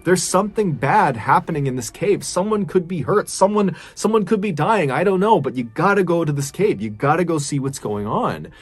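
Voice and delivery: acted-out frustrated pleading. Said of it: "he was kind of pleading with the police".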